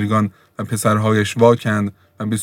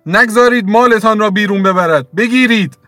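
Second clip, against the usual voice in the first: much higher, louder call for help; shouting to the children; 12 semitones higher, 5 dB louder than usual. Acted. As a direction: yell to the kids to block the door. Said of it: "فریاد زد".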